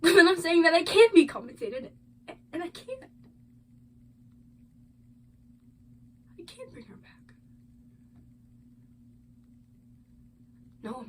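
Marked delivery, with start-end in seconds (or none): 0.0-1.9 loud, high burst of grief; acted anguish, voice raised; anguished protest. Acted grieving parent's statement. 6.4-7.1 barely voiced, anguished whisper; hushed acted grief; grief-stricken whisper. Acted grieving parent's statement.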